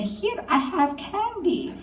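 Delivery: high, slow creepy candy-man voice; creepy stranger. Retold or imitated. Mother voicing the van man offering candy, high and slow. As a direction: lure them in, high and slow.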